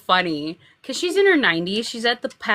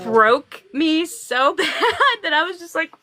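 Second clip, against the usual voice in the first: cracking up while telling it; laughing hard; 6 semitones higher than usual. Real, not acted. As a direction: voice breaking with laughter. Cracking up at her own story.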